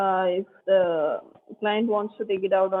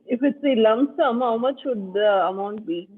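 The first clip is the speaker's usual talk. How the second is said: speaking up. Real, not acted. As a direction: speak up so they can hear. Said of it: "Sorry, your voice is very low".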